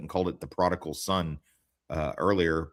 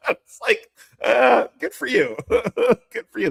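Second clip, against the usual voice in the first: laughing; 7 dB louder, slower than usual. Real, not acted. Laughing while talking.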